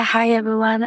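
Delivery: ordinary talk, nothing marked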